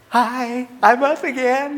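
a hint of a cheery infant; cheerful baby voice. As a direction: chirp it like a baby just up from a nap. Grandpa voices cheerful waking baby.

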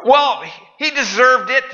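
high, slow mocking voice; mock excuse. Pastor parodying a self-justifying excuse, high and slow. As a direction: drawl the excuse high and smug.

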